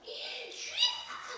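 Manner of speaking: breathy drawn-out mock prayer cry, very high; praying revival mother. Imitating old mothers at a revival, crying out slowly and very high. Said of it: "옛날 엄마들 기도하는 거 아시죠?".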